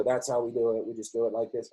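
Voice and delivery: a light imitation of a robot reciting "That's how we do it."; robotic coworker. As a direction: drone it like a programmed office robot. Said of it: "start seeing as robots".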